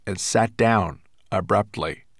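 ordinary delivery

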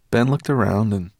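ordinary delivery, nothing marked

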